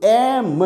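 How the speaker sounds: "एम" slowly stretched, opening on the vowel; vowel-first demonstration. Shown as a demonstration. Teacher proving to students that M starts with a vowel, slow stretched demonstration. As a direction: draw out "एम" so the first vowel stands out. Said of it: "पहला अक्षर है ए".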